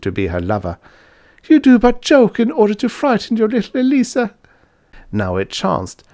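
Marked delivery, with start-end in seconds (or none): 1.5-4.3 put-on very high girlish character voice; loud, lovesick young lady; pleading maiden. A narrator's reading. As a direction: coax your lover in a high wail.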